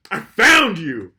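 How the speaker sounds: high, loud, stretched-out gotcha; triumphant mock shout. Podcaster to the exposed Facebook faker, gloating shout. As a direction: draw it out as a gloating yell. Acted confrontation of exposed scammer.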